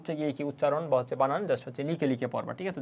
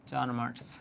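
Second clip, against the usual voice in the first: চার marks repeated softer, dropping away; 4 semitones lower, 6 dB quieter than usual. Shown as a demonstration. Teacher repeating the answer to students, quiet echo. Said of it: "তুমি marks পাচ্ছ কত?".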